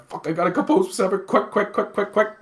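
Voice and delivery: high, fast, urging himself on; panicked self-talk. Retold or imitated. Groom telling himself to stop crying before the bride arrives, high frantic urging. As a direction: hurry yourself to pull it together.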